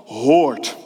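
normal talking voice

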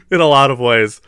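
ordinary talk, nothing marked

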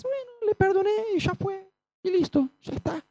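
high, breezy mock shrug; mock careless voice. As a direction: toss it off like nothing happened. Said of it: "No es que se queda así nomás". (voz aguda y despreocupada, en burla)

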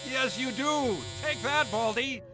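mocking laugh. Mocking the bald man.

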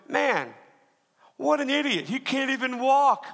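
high-pitched, exaggerated parental dismay; mock scornful parent. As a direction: parody a parent appalled at their child.

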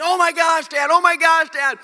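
very high, loud panicked cries; imitated daughter freaking out. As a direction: scream to dad as a terrified child would. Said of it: "So she starts freaking out".

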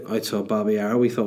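normal talking voice

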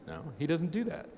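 ordinary delivery

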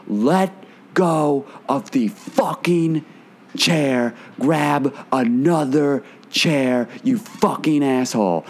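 retold angry scream. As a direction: spit out each word slowly, imitating her anger. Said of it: "like, scream at this guy".

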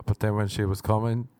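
ordinary talk, nothing marked

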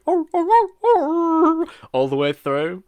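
howled like a singing dog; dog howl impression. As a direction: stretch it into a long dog howl. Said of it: "That was a solid impersonation there".

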